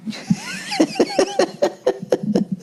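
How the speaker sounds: squeaky falsetto cackle; wheezy high laugh; high wheezing laugh. Real, not acted. Laughs at own joke.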